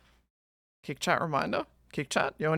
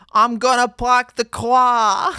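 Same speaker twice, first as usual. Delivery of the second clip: loud, slow, higher mock Boston accent; hammed-up Boston accent; 6 semitones higher, 11 dB louder, slower than usual. Streamer redoing the requested line, exaggerated Boston accent. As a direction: blare it out in a broad Boston accent, stretching "car".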